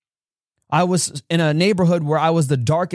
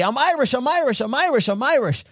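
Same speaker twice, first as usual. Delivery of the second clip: higher, hopeful repeated chant; eager child; 11 semitones higher than usual. Retold or imitated. Boy telling everybody at school, higher, eager chant. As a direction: announce it hoping to be accepted. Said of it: "I went around telling everybody".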